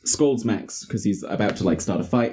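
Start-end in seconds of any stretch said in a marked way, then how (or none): none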